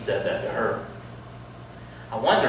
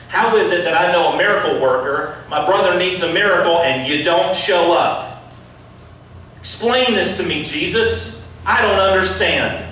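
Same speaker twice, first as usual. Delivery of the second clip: loud, higher pleading complaint; upset Martha; 4 semitones higher, 4 dB louder than usual. Acted. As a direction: demand an answer from Jesus. Martha confronting Jesus over brother.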